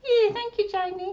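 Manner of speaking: put-on high little girl puppet voice; squeaky childlike puppet; Susie puppet voice. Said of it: "does Susie practice".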